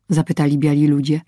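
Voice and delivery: ordinary delivery, nothing marked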